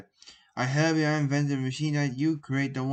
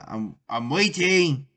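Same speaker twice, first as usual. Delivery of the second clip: impatient; 6 dB louder, slower than usual. A narrator's reading. Professor demanding answer.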